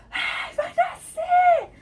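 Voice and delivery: high, slow shouted scream; screaming it's coming. Retold or imitated. Mother showing how she screamed in labour, high drawn-out shout. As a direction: yell it out mid-labour. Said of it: "quando eu berrei".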